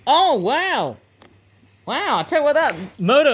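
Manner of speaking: high, loud burst of delight; thrilled by the motor's sound; real excited gasp of wonder; excited wow. Real, not acted. Motor starts up surprisingly strong.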